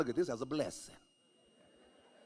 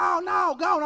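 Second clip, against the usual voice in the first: high, loud dismissive yell; wife yelling back; 10 dB louder than usual. Retold or imitated. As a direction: wave him off loudly. Wife yelling through door.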